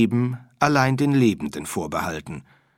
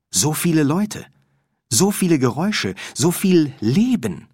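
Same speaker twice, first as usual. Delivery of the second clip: excited wonder; 6 semitones higher, 4 dB louder than usual. A narrator's reading. Windle marvels at the city.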